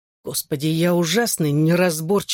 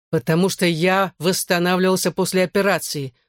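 ordinary delivery, nothing marked

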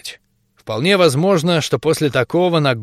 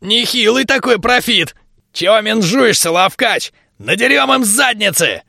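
excited; 7 semitones higher than usual. A narrator's reading. Torfu urges the fight.

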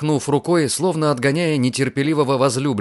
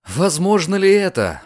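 anxious raised question; alarmed exclamation; 6 semitones higher, 3 dB louder than usual. Acted. Lucian alarmed at Diana's condition, exclaims. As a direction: gasp it out in worry. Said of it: "в тревоге воскликнул он".

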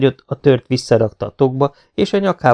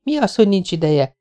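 higher, agitated burst; near-hysterical outburst; 8 semitones higher than usual. A narrator's reading. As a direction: snap out at him almost hysterically. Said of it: "fakadt ki váratlanul, szinte hisztérikusan". (szinte hisztérikus kitörés)

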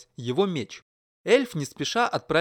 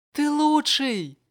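high, slow joyful shout; cheering shout; 12 semitones higher, 4 dB louder, much slower than usual. A narrator's reading. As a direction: call it out proudly. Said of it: "крикнула она".

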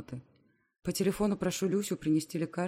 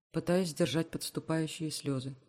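ordinary delivery

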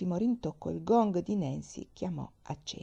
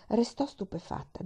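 ordinary delivery, nothing marked

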